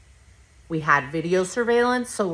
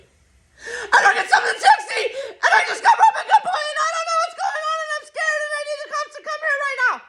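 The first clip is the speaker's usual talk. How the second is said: frantic, breathless, much higher and fast, with nervous laughs; panicked rush. Retold or imitated. Reporting a robbery to 911, frantic and rushed. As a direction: blurt it all out in one terrified rush. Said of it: "I say it like nine hundred miles an hour".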